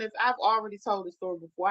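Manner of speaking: normal talking voice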